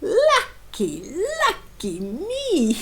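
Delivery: gleeful wizard chuckling through the words; laughing. Acted. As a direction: laugh as you gloat over your find.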